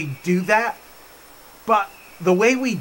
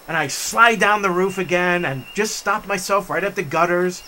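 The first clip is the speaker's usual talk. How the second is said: ordinary delivery, nothing marked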